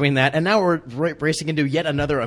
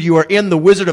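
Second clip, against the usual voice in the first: louder, higher disbelieving exclamation; exasperated mock protest; 4 semitones higher, 9 dB louder than usual. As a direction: exclaim it like talking sense into someone. Acted exasperated plea to grumpy crewman.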